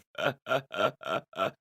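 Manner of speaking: gasping backwards laugh, pulled inward. Shown as a demonstration. Clacker showing off a laugh type, inward laughing. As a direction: laugh on the in-breath. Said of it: "That's the inward laughing".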